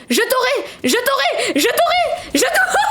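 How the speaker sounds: rising squeal of battle frenzy; frantic taunt. Real, not acted. Chasing an enemy, attacking wildly. (menace hystérique de plus en plus aiguë)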